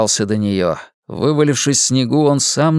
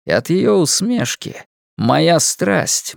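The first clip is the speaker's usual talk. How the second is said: usual speaking voice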